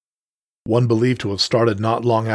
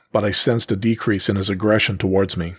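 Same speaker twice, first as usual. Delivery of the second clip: usual speaking voice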